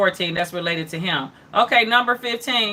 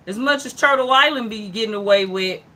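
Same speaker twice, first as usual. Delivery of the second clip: ordinary talk, nothing marked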